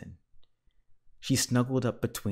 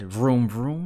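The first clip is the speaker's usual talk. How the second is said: louder revving car noise, rising end; lighter engine vroom; 2 semitones higher, 6 dB louder than usual. A narrator's reading. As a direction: rev it up like a toy car. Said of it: "Mister Watson said".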